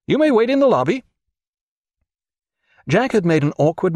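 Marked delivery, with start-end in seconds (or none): 0.1-1.0 high-pitched, officious dismissal; agitated official dismissing. A narrator's reading. Brenton sends Bulwer to the lobby, high and officious. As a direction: send him out in a high, fussy voice.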